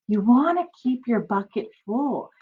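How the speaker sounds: ordinary delivery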